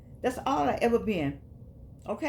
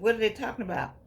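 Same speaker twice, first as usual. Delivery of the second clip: usual speaking voice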